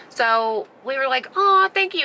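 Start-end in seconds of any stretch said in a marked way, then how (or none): 1.4-2.1 high-pitched, drawn "aw" of gratitude; gushing thanks. Retold or imitated. Campers thanking neighbor for the lantern, gushing. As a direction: imitate sweet, effusive thanks, pitched way up.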